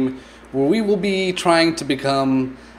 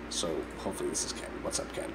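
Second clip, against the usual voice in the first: whisper; 10 semitones lower, 16 dB quieter, much faster than usual. Real, not acted. Whispering approach to Ken.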